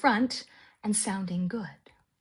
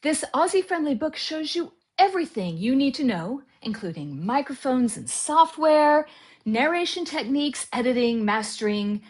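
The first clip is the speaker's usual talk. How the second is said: ad read; 4 dB louder than usual. Acted. Narrator pitching his audiobook guide, loud ad read. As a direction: sell the book with energy.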